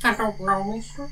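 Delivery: put-on high, soft, babyish mumble; a half-hearted high, soft Waterboy imitation. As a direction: squeak it out like the Waterboy. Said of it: "What's his stupid little voice for the water boy".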